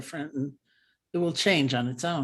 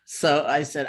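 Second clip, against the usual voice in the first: ordinary talk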